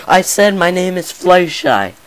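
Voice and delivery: high, loud shy pony screaming her name; pony finally screams her name; sudden scream. Acted. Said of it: "The sudden scream".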